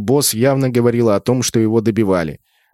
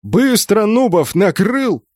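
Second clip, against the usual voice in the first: high, punched-out command; urgent, furious officer's shout; yelling; 9 semitones higher, slower than usual. A narrator's reading. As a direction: yell an order word by word. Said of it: "заорал на змеевика".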